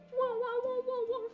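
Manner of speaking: high, loud whooping "whoa"; excited whoas. Acted. Lancer voice exclaiming in inventory.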